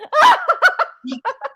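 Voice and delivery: laughing. Real, not acted. Bursts out laughing.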